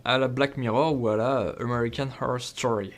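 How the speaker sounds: hammed-up, disgusting American accent on the titles; hyper-American accent. Reviewer showing off name-dropped series, in an awful hyper-American accent. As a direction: lay on a thick mock American accent. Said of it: "mon accent hyper américain dégueulasse".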